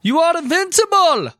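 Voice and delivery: shouted mock Jamaican accent, very high; high, loud put-on Jamaican oracle; Miss Cleo. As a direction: shriek it as a hammy Jamaican psychic. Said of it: "at which point she shouted".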